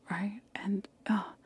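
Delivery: ordinary delivery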